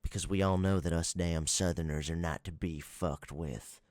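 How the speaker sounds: exaggerated Southern accent; mock Southern accent. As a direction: put on an angry Southern farmer accent. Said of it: "accent of the Southerners".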